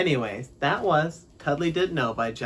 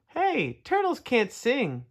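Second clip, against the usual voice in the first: high, drawn-out insect call; shouting katydid; 9 semitones higher, slower than usual. A narrator's reading. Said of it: "a katydid shouted".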